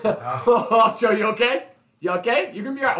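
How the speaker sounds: lightly voiced worried check-in; a hint of fussing concern; mock concern. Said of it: "I was like".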